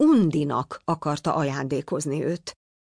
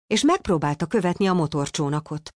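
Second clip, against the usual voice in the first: ordinary delivery, nothing marked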